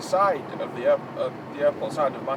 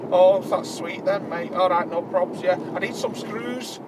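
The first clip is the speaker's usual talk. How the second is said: 1 Manc chav; 4 semitones higher than usual. Retold or imitated. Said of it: "He went".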